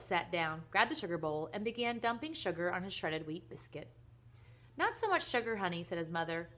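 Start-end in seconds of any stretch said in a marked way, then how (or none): 4.8-5.8 high-pitched, louder motherly chiding; lightly scolding mom. Acted. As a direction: chide a child sweetly in a high voice. Mother gently corrects her son.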